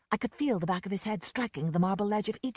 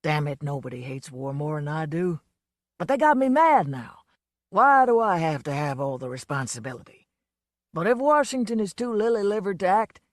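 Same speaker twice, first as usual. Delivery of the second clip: a lightly voiced boozy Southern showman; a hint of a drunk country star, fed up; lightly played drunk blowhard; Lonesome's voice; 6 dB louder than usual. A narrator's reading.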